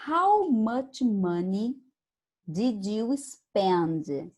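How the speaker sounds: how much money did you spend said very slowly and carefully; slow reading. Shown as a demonstration. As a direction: read the question slowly, word by word. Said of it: "Number seven".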